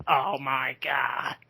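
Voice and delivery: slow, drawn-out exclamation; Joey Styles impression. Showing how Marco does Joey Styles, slow stretched impression. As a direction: lightly mimic a stunned announcer, long vowels. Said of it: "he could do a good Joey Styles impression".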